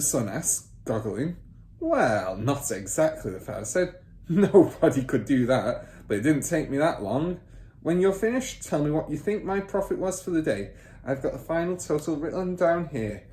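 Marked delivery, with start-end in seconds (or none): 1.8-13.2 lightly voiced smug car dealer father; boastful father. Father bragging to son about cheating buyers, lightly smug. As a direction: brag a little louder, pleased with himself.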